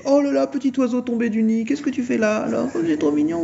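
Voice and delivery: mock romcom hero cooing; smooth Jason. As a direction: coo at her like a charmed movie hunk. Jason voiced in parody story.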